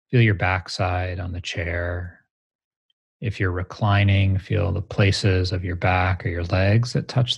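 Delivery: grounding exercise. Shown as a demonstration. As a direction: guide them to feel the ground. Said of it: "let's meditate together right now".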